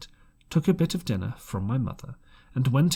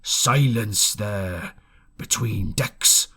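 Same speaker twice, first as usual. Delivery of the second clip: slow, lightly voiced gruff pirate command; villainous oath; 5 dB louder, much slower than usual. A narrator's reading. Captain silencing the room at the inn, slow gruff command. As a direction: growl a slow pirate order. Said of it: "broke out with a villainous low oath".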